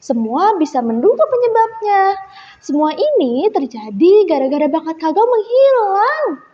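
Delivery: ordinary delivery, nothing marked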